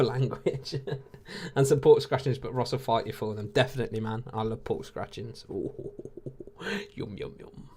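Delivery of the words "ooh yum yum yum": soft, breathy yum yum of craving; hushed, playful lip-smacking relish; mock relish. Real, not acted. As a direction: savour the thought of the snack. Craving pork scratchings.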